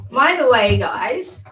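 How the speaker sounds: normal talking voice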